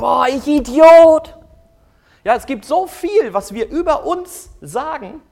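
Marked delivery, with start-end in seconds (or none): 0.0-1.4 loud, slow, high groan at yourself; exasperated self-insult. Retold or imitated. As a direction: berate yourself out loud, drawn out. Selbstbeschimpfung nach Fehler. (genervter, lauter Selbstvorwurf)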